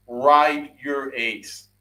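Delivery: each word spaced out and hammered; emphatic, slow. Real, not acted. Fan ranting to chat, slowly stressing every word. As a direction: lay down the rule slowly, word by word. Ranting about manager's choices.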